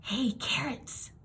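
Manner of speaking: slow teasing whisper; cheeky schoolboy whisper. A narrator's reading. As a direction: hiss the taunt under his breath. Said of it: "he whispered".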